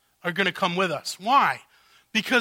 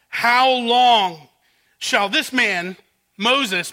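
loud, slow, pleading cry of the royal court; pleading outcry; 4 semitones higher, 6 dB louder, much slower than usual. Acted. Servants crying out to Pharaoh, loud and slow. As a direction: drag out each word as if begging a king. Preacher voicing the servants' plea.

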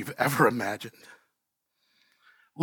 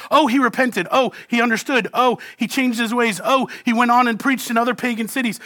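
mock pat answer; 7 semitones higher than usual. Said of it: "The text doesn't tell us".

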